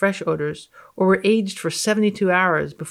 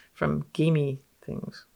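normal talking voice

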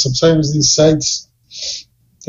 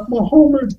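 high-pitched parody of a captive; Roots captive; 10 semitones higher than usual. Pretend. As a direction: answer as the overseer's parodied victim. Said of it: "are you doing a Roots reference?".

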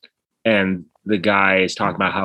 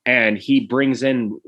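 ordinary delivery

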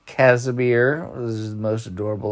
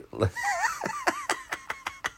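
short high-pitched laugh; squeaky little laugh; high giggle; 9 dB quieter than usual. Real, not acted. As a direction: giggle at your own yawning. Laughs at himself.

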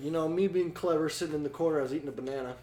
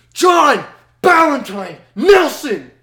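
loud, high yell, each name drawn out; slow, booming full-name summons; imitating angry mom; 11 semitones higher, 15 dB louder, slower than usual. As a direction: holler the full name like a mother in trouble mode. Mom summons son in anger.

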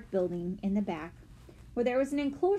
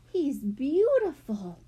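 slow, higher-pitched wonder; breathed in awe; 5 semitones higher, 4 dB louder, slower than usual. A narrator's reading. Violet admiring the peacock, slow and awestruck. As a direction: draw it out in admiration. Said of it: "breathed Violet".

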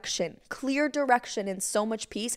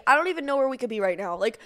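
usual speaking voice

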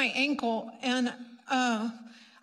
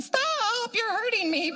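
mock squeal; 10 semitones higher than usual. Imagined plea to the man.

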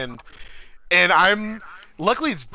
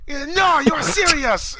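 much higher, louder incredulous exclamation; disbelieving driver; 4 dB louder, much slower than usual. Retold or imitated. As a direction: refuse to believe what you just heard. Driver still can't believe it.

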